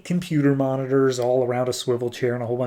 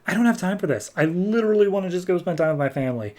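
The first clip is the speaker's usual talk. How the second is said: higher, rushed mock exasperation; acted impatience, pitched up; exasperated complaint; 6 semitones higher, faster than usual. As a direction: protest like you're fed up. Hero fed up during fight.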